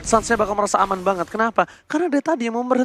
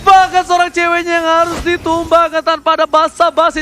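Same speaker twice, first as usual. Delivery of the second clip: loud, high play-by-play shout; hyped caster shout; 8 semitones higher, 7 dB louder than usual. Real, not acted. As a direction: yell the team fight live. Caster hypes a kill. (teriakan caster yang heboh)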